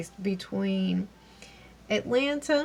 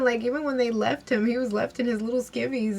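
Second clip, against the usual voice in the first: ordinary talk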